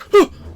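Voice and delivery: sharp, loud gasp of shock; sudden intake of breath; acted gasp. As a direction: gasp like startled listeners. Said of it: "a couple of other voices like".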